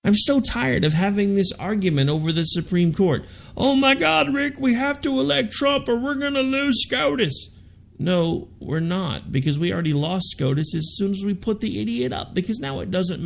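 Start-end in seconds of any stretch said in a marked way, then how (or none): 3.6-7.5 high pleading mock voice; mocking whiny voter. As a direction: whine it like a panicked voter.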